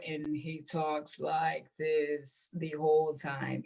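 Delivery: lightly mock a sleepy commercial voiceover; Clear Eyes ad guy. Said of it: "the guy that does the clear eyes".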